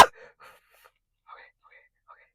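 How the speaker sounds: breathy whisper to herself; shaky self-soothing whisper. Real, not acted. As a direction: whisper to steady your nerves. Calming herself after screaming.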